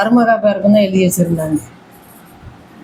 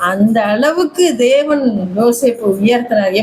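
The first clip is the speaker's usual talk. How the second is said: ordinary delivery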